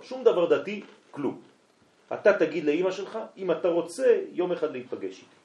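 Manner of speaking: high, loud, eager pleading; loud eager plea to come meet again; excited urging. Retold or imitated. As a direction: push the invitation up high and loud with feeling. Urging the boy warmly, voice rising.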